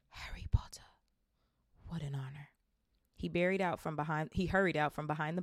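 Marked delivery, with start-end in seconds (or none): 0.1-2.5 whisper. Acted. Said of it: "whispered the old bartender".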